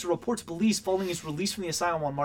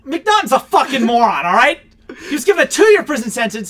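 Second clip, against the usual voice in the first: loud, high angry rant; furious shouting; frustrated yell; 8 semitones higher, 12 dB louder than usual. Real, not acted. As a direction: bark it at the ceiling. Yelling frustration at source.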